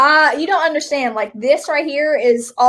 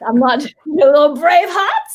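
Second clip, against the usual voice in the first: put-on Scottish accent; 3 semitones higher, 5 dB louder than usual. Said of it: "as if you were in their country".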